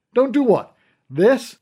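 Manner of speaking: mock the warning with a dare; taunting.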